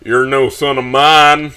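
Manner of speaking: slow, lightly voiced stern dad; disowning father. A narrator's reading. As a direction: disown the son slowly. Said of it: "breathe in with a cold fury until my father finally speaks".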